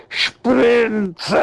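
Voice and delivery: a high, crying call for Sprinze; anguished cry of a name. Acted. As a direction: cry her name out in despair. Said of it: "hört er, wie einer schreit". (verzweifelter Aufschrei, hoch)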